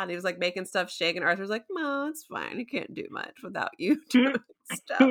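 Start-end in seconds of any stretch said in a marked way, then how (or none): 1.7-5.1 high, airy mock dismissal; imitating unbothered Arthur. Arthur brushing off raging Khonshu, lightly mocked nonchalance. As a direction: wave it off in a higher voice.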